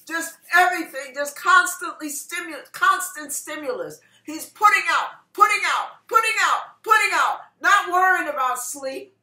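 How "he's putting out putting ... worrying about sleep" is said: loud, high, rhythmic repetition; driving preaching. Real, not acted. Preacher telling of the boyfriend's tireless effort, driving and fervent. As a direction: hammer each phrase home with fervor. Preacher drives repeated phrases.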